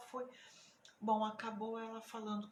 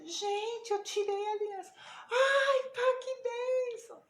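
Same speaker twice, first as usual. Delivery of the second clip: high, loud acted-out exclamation; re-enacted gushing excitement; excited announcement; 11 semitones higher, 8 dB louder, slower than usual. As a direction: blurt the news out, then stretch the reaction high. Acts out announcing the ring's removal.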